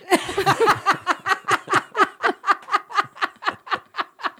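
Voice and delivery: high, long real laugh. Real, not acted. Teller laughing at her own joke, high and long. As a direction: crack up at own punchline.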